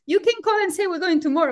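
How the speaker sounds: high-pitched mock husband; retold breezy excuse, pitched well up; imitating husband on a call. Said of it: "he calls me from the car and say".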